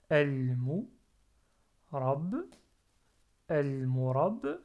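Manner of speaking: "el murab" fort et lent, découpé en syllabes; séparer "el mu" de "rab", puis les joindre; syllabes détachées. Shown as a demonstration. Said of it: "en trois syllabes". (« el murab » décomposé, b final marqué)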